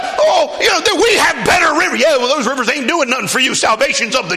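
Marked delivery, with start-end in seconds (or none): 0.0-2.0 much higher, louder scornful shout; indignant Naaman. Acted. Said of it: "And he's like". 2.0-3.4 much higher, louder shouting preach; full-force preaching. Acted. Preaching Naaman's pride.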